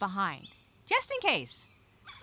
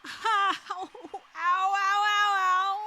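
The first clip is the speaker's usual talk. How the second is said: higher, louder yelps of pain; pained cries; 8 semitones higher, 4 dB louder, slower than usual. Acted. Castaway who just tripped on the trail, pained yelps. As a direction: cry out after a hard fall.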